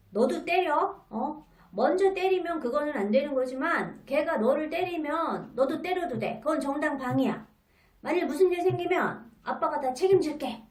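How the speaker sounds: fired-up father, pushing hard; dad loudly backing his son to fight back, fast at the end; forceful dad. Retold or imitated. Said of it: "강한 방법을 제시하곤".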